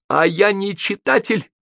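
high, drawn-out indignant bark. A narrator's reading. Гоголь snaps back at Тургенев's question, barking. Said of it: "рявкнул Гоголь".

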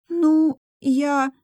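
high, halting little voice; embarrassed, hesitant stammer; flustered attempt. A narrator's reading. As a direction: stall shyly, pitched well up. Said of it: "пыталась Юнсу".

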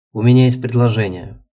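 usual speaking voice

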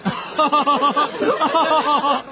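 laughing. Acted. Fred laughs with crowd.

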